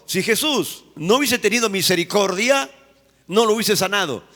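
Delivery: usual speaking voice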